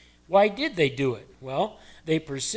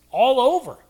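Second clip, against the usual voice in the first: triumphant exclaim; 8 semitones higher, 10 dB louder than usual. Acted. Preacher exclaims the healing.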